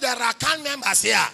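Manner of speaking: a pastor replaying his challenge at the door, loud and defiant; defiant retold shout. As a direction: throw it back at him. Retold standoff with gatekeeper.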